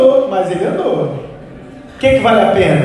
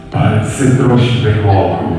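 deep voice of Jesus; 12 semitones lower than usual. Retold or imitated. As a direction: answer low and unhurried as God. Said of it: "a voz de Jesus é grossa". (voz grossa e lenta de Jesus)

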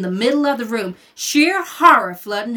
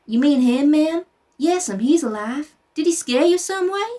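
gently; 6 semitones higher, 3 dB louder than usual. Acted. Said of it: "he said gently".

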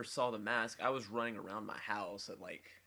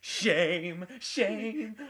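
higher, louder, laughing shame chant; Jim Carrey impression; 10 semitones higher, 8 dB louder than usual. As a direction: belt it out through a laugh. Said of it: "It was".

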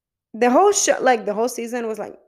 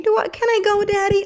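put-on high-pitched child voice, pleading; much higher, childlike question; little kid; 10 semitones higher than usual. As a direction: imitate a small kid asking daddy. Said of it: "and the kid is like".

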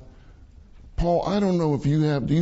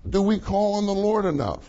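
usual speaking voice

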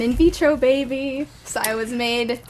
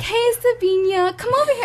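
put-on high, sing-song little-kid call; childish call across the playground; 11 semitones higher than usual. Little twin calling her sister on the playground, high sing-song call. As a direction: call out to your twin like a toddler at play. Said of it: "We would be like".

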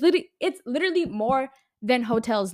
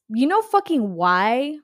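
louder, slow, drawn-out challenge; angry rhetorical question; 2 semitones higher, 7 dB louder, slower than usual. Real, not acted. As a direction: lean into every word, fed up. Ranting about nobody wanting work.

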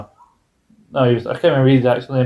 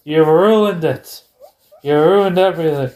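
higher-pitched, drawn-out mock lament; mock outrage; 4 semitones higher than usual. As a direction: play up the betrayed fan. Mock outrage at Hogan's betrayal.

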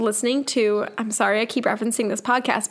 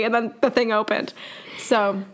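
ordinary delivery

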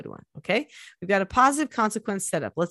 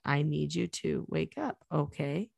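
softer and slower, a gentle wake-up voice; 4 semitones lower, 8 dB quieter than usual. Shown as a demonstration. Mother waking her sleepy child, soft and slow. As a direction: ease them awake gently. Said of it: "I always say it like that".

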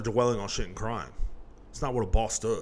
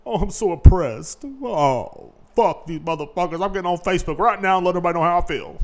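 mock whiner; 5 semitones higher, 5 dB louder than usual. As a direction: whine it like someone crying to Facebook. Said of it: "fighting for your freedom is sitting around bitching".

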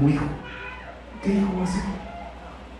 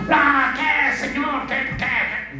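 higher, half-shouted cheeky challenge to God; mock arrogant demand; 10 semitones higher, much slower than usual. Proud believer talking back to God, mock arrogant shout. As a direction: imitate someone talking back to the Lord. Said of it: "no vaya usted a pensar así como".

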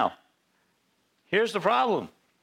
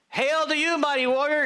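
high, loud heavenly proclamation; booming angel greeting; 14 semitones higher, 3 dB louder than usual. Acted. Angel of the Lord hailing Gideon, high and loud. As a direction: announce it like a herald from heaven.

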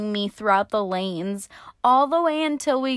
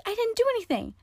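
indignant disbelief; 13 semitones higher, 4 dB quieter, much faster than usual. Retold or imitated. Protesting to the cop.

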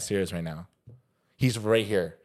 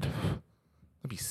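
whispering; 3 semitones higher, 8 dB quieter than usual. Real, not acted. Joking aside about allergy.

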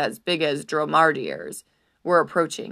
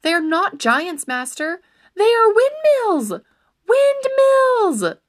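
building from a raised plea to a high, loud cry; urgent shouting; 13 semitones higher, 8 dB louder, slower than usual. Acted. Squire shouts at his charging master, building to a high, loud cry. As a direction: shout the warning louder and higher each time. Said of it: "his squire's urgent cries".

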